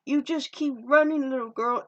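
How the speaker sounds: imitated taunt. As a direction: mimic someone egging a runaway on. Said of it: "She's like".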